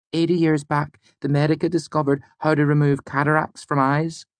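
high-pitched student storyteller; Fela. Acted. Student telling the lecturer a medical discovery, high-pitched. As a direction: explain the fact in a high, even voice. Student tells her fact.

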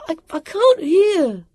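high, slow, loud whine; sulky, drawn-out shout; petulant. A narrator's reading. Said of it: "Sam said petulantly".

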